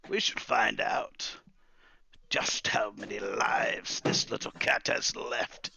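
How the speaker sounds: slow, loud, breathy threat; drawn-out villainous relish; old wizard, menacing. Acted. Wizard threatening the cat's lives to Calamus, menacing.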